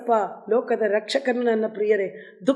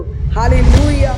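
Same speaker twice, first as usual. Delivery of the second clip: slow, stretched and much louder praise cry; loud drawn-out hallelujah; 8 dB louder, much slower than usual. Real, not acted. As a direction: hold each syllable out in jubilant praise. Preacher exclaims praise. (ದೀರ್ಘವಾಗಿ ಎಳೆದ ಜೋರಾದ hallelujah)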